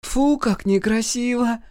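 put-on high, slow, teasing child voice; mock-disgusted little boy, drawn out; giggly child. A narrator's reading. Микки teases sister picking nose, giggly. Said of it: "хихикнул Микки".

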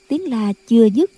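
usual speaking voice

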